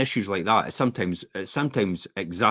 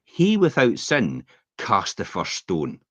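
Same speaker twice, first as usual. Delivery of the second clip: slow preachy quote; slower than usual. Retold or imitated. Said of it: "it's like".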